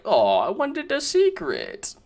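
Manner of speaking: normal talking voice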